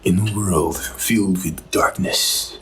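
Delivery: slow, deep movie trailer narration; booming blockbuster announcer; epic trailer voice. Pretend. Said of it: "I have to put on my epic trailer voice".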